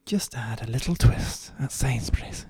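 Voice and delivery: slow smooth advert tagline; ad voiceover. Acted. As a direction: read it like a TV ad slogan. Ad slogan voiceover.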